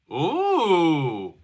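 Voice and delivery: long ooh that swoops up, then slides down; drawn-out ooh. Real, not acted. Reacts to changing background.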